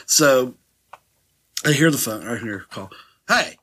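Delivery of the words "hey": loaded hey. Retold or imitated. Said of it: "She used that hey".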